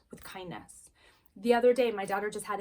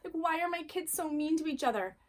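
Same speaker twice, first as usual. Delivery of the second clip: higher-pitched exasperated lament; disheartened frustration; 6 semitones higher than usual. Acted. Parent despairing over squabbling kids, exasperated. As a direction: plead in weary frustration, pitched up. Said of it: "mad or irritated or frustrated, disheartened, just so down".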